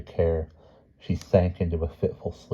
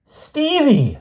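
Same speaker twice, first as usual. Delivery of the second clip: piercing high scream of a name; loud, very high cry; 7 dB louder than usual. A narrator's reading. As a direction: scream out for him. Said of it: "screamed Lynn".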